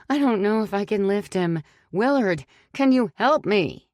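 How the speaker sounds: calling to Willard for help lifting the body; desperate. A narrator's reading. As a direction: beg for help, out of breath. Straining, calling to absent Willard.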